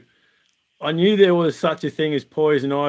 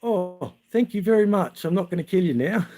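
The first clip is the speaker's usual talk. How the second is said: lightly acted, higher-voiced grateful mate; relieved mate; 5 semitones higher than usual. Soothed mate forgives friend for river push, mock relieved. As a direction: imitate sudden relief after rage, high then quicker. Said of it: "And he goes".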